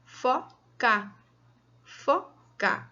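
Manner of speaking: "fo", pause, "ca"; syllable by syllable. Shown as a demonstration. Teacher dividing a word for children, syllable by syllable. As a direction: split "foca" into two separate beats. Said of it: "Então, eu dividi ela".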